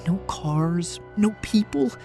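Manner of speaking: usual speaking voice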